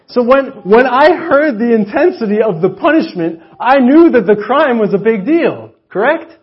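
loud, high preaching with slow weighty stresses; full-force sermon delivery. Real, not acted. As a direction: drive the point home to the congregation.

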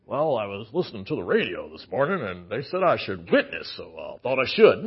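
low mocking impression of a churchgoer. Mocking a shallow churchgoer's reasoning.

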